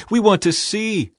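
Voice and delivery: high-pitched, earnest request; eager plea. A narrator's reading. Blind men beg Jesus for sight, high eager plea.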